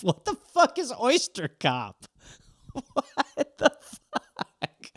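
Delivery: soft, slow words broken by laughter; cracking up at the prompt; laughing disbelief. Real, not acted. Baffled by absurd suggestion.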